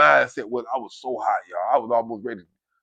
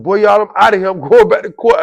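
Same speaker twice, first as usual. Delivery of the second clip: loud, high, elated; celebrating at full voice; jubilant announcement; 6 semitones higher, 10 dB louder than usual. Retold or imitated. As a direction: crow it to the whole dorm. Retold joy at going to court.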